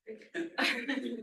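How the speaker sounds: brief embarrassed laugh; a quick sheepish chuckle; short laugh. Real, not acted. Speaker laughs at audience joke.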